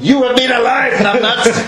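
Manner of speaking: amused imagined comeback to the official; mock retort. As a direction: laugh it out as a cheeky insult.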